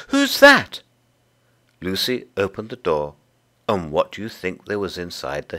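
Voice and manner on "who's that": high, loud startled call; frightened call. Acted. Frightened singer calls to whoever knocked, high and startled. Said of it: "A little frightened voice called out".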